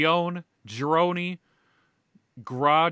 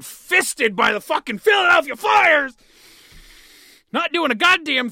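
frustrated fan yelling, higher and louder; furious rant shout; angry shout; 12 semitones higher, 8 dB louder than usual. Real, not acted.

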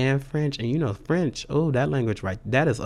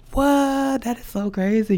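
shocked what; 12 semitones higher than usual. Real, not acted. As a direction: squeal in disbelief. Reacting to own realization.

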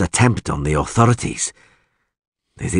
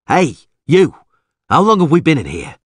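angry, raised-voice demand; demanding shout; 9 semitones higher, 6 dB louder than usual. Acted. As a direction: call out loud and high to strangers. Calls out to other prisoners.

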